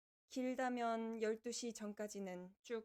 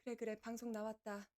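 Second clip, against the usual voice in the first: ordinary talk, nothing marked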